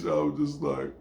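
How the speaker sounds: normal talking voice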